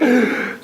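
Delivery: high, sharp mock gasp; acted-out gasp of shock. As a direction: gasp as if a secret just came out. Prof reacts to dark secret.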